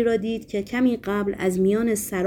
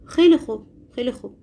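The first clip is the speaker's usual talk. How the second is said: feigned agreement; 3 semitones higher, 3 dB louder, faster than usual. A narrator's reading. Berlioz humours the madman, pretending to agree. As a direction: humour him with a hasty show of agreeing.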